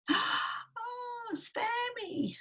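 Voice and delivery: very high, squeaky mouse cry; pleading little mouse. Acted. Mouse begging the lion for mercy, high pleading cry. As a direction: beg in a tiny high voice. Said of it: "cried the mouse".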